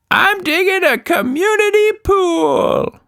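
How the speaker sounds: high, slow silly monster; a goofy creature proud of its digging. Acted. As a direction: monster answering the father cheerfully. Monster answers father.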